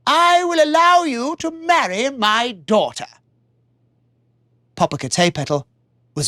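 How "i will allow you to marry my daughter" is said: a lightly higher, regal king making an offer; king's voice. Acted. King offering his daughter's hand, regal. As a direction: promise it like a king.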